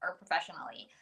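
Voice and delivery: glitchy, dropping-out connection; corrupted glitchy audio. Real, not acted. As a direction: audio breaking up mid-word. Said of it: "Your audio's cutting out a teeny bit".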